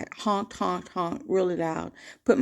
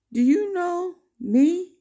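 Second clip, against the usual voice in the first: slow, higher, louder mock shout; imitating an angry yell; 10 semitones higher, 5 dB louder, slower than usual. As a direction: bark it like the furious driver. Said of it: "screaming in his".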